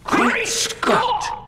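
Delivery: high whispered gasp; shocked gasp. Acted. Boy reacting to shocking news with a high whispered gasp.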